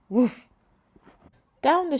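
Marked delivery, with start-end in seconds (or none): 0.1-0.5 a single playful bark; dog bark. Acted. The dog Manny greeting, playful bark. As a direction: bark once like a small dog.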